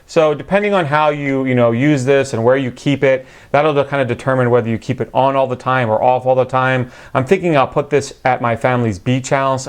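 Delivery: normal talking voice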